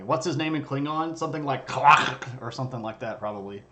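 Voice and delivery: normal talking voice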